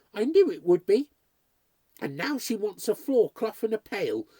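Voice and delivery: high, loud, horrified protest; distressed outcry. A narrator's reading. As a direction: cry out in agitated refusal. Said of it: "she cried".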